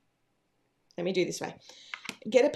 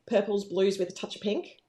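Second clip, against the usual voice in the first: ordinary delivery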